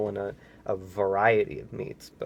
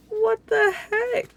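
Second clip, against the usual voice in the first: high, loud, slow what the heck; outraged disbelief; 5 dB louder, slower than usual. Real, not acted. Reader squeals in outrage at the mean shopkeepers.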